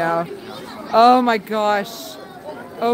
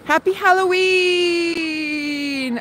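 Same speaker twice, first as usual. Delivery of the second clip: drawn-out greeting; 6 semitones higher, much slower than usual. Real, not acted. Calling a greeting to viewers.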